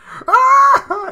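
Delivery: high shrieking burst of laughter; screaming with laughter. Real, not acted. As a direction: crack up loud and high. Big laugh after Waterboy quote.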